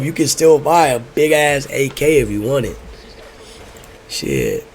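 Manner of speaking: usual speaking voice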